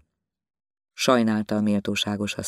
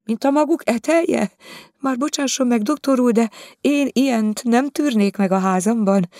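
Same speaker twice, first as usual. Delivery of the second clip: higher, complaining lady's voice; indignant lady; 13 semitones higher, 5 dB louder than usual. Acted. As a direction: voice her as a prim, offended lady. Viziné complains to the doctor. (felháborodott úriasszony magasabb hangon)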